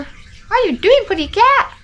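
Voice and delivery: very high, cooing voice for the cat; pet voice. Real, not acted. Greeting her pretty cat mid-vlog, high cooing pet voice.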